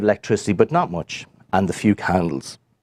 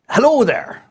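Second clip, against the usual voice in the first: high little fairy greeting; wee fairy voice; 13 semitones higher, 4 dB louder than usual. Acted. Storyteller voicing the wee fairy man's high hello. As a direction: pitch it up like a tiny man.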